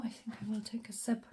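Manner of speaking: quick unvoiced whisper; a fast whispered aside.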